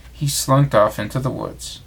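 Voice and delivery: plain reading voice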